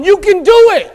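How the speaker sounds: loud, high cheer of encouragement; rooting voice. Retold or imitated. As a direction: shout it like a coach behind you. Imagined cheerleader behind you.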